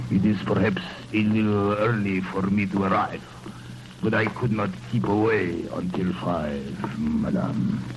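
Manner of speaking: put-on a lover disguised as a Russian prince, in a Russian accent. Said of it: "You're as Russian as the Tsar".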